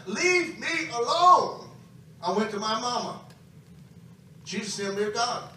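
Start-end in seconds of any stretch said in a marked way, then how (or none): 0.1-1.7 loud, drawn-out shout of defiance; fist-in-the-air holler, loud and slow; defiant cry. Retold or imitated. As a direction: yell it slowly, every word stretched. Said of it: "crying, threw my".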